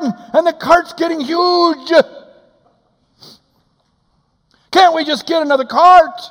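loud, high, fast comic complaining; exasperated husband arguing; wife and husband bickering over a full cart; heated comic rant. Retold or imitated.

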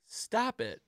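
high-pitched mimicry of the wife; imitated plea. Quoting the wife looking at her hostess, in a high imitating voice. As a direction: pitch it way up, echoing her protest. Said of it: "she was saying, like".

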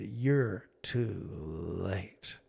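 low, soft, very slow reproach; a son's quiet, heavy accusation to his father; bitter grief. Retold or imitated. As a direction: let each word sink, hurt and quiet.

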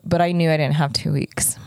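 ordinary delivery

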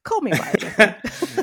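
drawn-out Australian tease; mock Australian. Guest joking back in a slow mock Australian accent. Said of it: "answering the mock accent".

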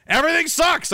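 high-pitched exasperated burst; exasperated outburst. Pretend. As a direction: blurt it out in comic despair. Sudden exasperated outburst mid-rant.

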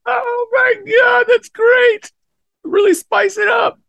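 very high, loud excited squeal. Real, not acted. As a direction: shriek with delight. Squealing with laughter.

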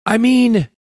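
high-pitched mock-serious quote; very high, put-on solemn voice; mock super serious. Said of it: "he, like, super serious goes".

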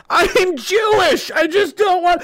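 high, pleading wail; hammed-up cry of the wrongly accused; mock desperate protest. Acting accused questioner protesting at attackers.

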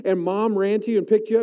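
ordinary talk

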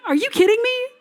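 quick, high-pitched incredulous question; angered disbelief. Retold or imitated. Preacher reacting to Saul's arrogance at home, incredulous. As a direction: fire it off in outraged disbelief. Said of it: "literally angered me".

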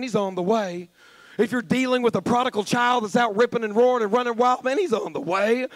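usual speaking voice

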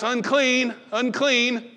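loud, high repeated call; leper's cry. Acted. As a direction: call out the warning three times. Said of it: "I'm gonna remind you and everyone else".

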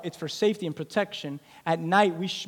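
ordinary talk

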